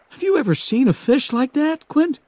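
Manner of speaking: high, eager voice full of wonder; excited young Hooper. Acted. Hooper marveling to Quint about the shark, high and eager.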